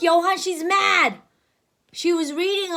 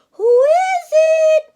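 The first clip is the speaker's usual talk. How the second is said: high, loud, slow fake granny; wolf pretending to be sweet granny; sweetest granny voice; 13 semitones higher, 8 dB louder, slower than usual. Wolf calling out as granny, high falsetto. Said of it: "in his sweetest granny voice".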